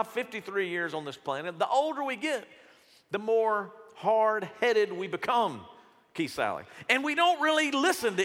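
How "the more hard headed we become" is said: ordinary talk, nothing marked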